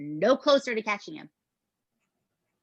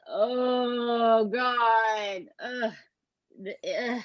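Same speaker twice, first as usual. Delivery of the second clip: long drawn-out, higher groan of dismay; pained dismay; 4 semitones higher, much slower than usual. Real, not acted. Host reacting to a grim interview, pained dismay. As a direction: stretch it out, pained and appalled.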